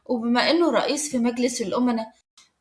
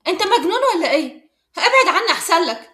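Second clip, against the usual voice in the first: higher, louder, indignant rebuff; sharp annoyance, pitched up; annoyed; 5 semitones higher, 5 dB louder than usual. A narrator's reading. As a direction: warn him off, fed up. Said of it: "قالت بضيق".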